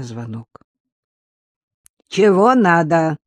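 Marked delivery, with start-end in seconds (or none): 2.1-3.2 put-on gruff old woman, slow and loud; old woman through a door. A narrator's reading. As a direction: bark it out like a suspicious granny. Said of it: "послышался из-за двери старушечий голос". (старушечий голос из-за двери)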